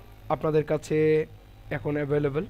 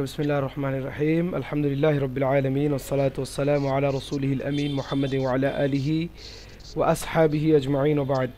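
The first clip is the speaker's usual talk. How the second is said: formal opening recitation; 4 semitones lower than usual. Acted.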